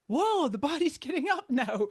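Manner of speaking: high, lightly amazed; mock surprise. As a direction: play the body's sudden move. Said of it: "gonna wait until the body is like".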